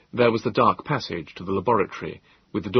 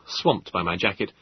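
ordinary delivery

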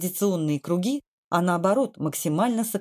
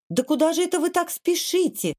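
higher-pitched indignant call; mild cry; 9 semitones higher than usual. A narrator's reading. Kvardli chasing the hurrying admirals, a mild high cry. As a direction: call out in protest, pitch raised, volume only slightly up. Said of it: "закричал он".

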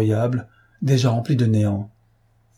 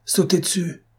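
shouted order; 8 semitones higher than usual. A narrator's reading. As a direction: yell the men into attack. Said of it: "criait M. Weber". (ordre crié, voix haut perchée)

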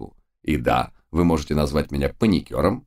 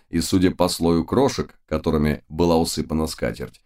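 plain reading voice

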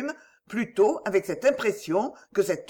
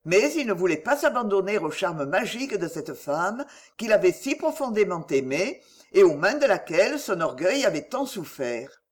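ordinary delivery

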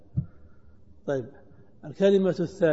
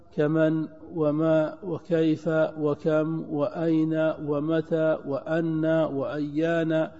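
slow listing; much slower than usual. Shown as a demonstration. Teacher lists question words slowly.

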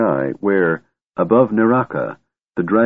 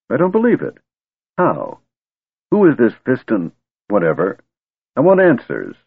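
higher, insistent demand; demanding answers; 5 semitones higher, 3 dB louder than usual. Acted. Caramon demands answers from the wizard, insistent. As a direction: push hard for answers.

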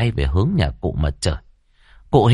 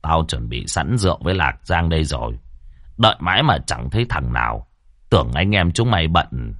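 put-on low, gentle chiding of an old host; genial old man; 5 semitones lower than usual. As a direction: grumble fondly at the late young men. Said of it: "đoạn cười cười".

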